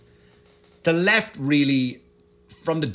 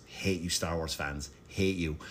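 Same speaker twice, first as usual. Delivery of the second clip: much softer, muttered mock insult; mock aside; 9 semitones lower, 11 dB quieter than usual. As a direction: toss it away under your breath. Mock aside to Star Wars fans.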